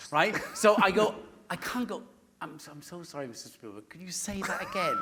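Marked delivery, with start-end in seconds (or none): 2.4-5.0 soft, hushed polite question; hushed, nervous politeness; timid. Retold or imitated. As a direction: tiptoe the request.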